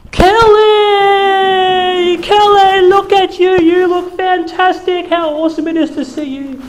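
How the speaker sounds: shrill reunion squeal at a celebrity; gushing fan greeting. Retold or imitated. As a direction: gush over her like an excited old classmate. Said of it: "this is what happened everywhere I went".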